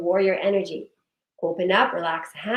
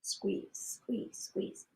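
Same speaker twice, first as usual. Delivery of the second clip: whispered coaching; 16 dB quieter, much slower than usual. Shown as a demonstration. Coaching a held muscle squeeze.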